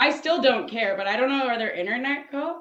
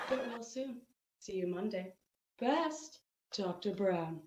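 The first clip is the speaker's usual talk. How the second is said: much softer, falsely polite sign-off; mock sweet sign-off; 3 semitones lower, 12 dB quieter than usual. The professor signing off the email in a soft, fake-polite voice. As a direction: drop to a quiet, sugary close. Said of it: "they'd be like".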